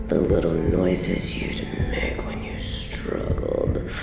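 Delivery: low, slow, breathy relish; sultry longing. Acted. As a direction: linger over each phrase, sinking toward a whisper. Captor lingers on captive's body.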